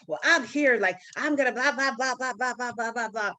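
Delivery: dismissive mock chatter; mocking babble. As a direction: run of blahs tailing off. Mocking Marcelo's babbling excuses.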